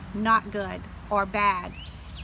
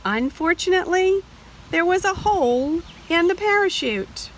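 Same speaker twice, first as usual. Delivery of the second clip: higher, tense storytelling; dramatic alarm; 7 semitones higher than usual. A narrator's reading. Teacher reading to children, alarmed turn. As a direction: raise the stakes.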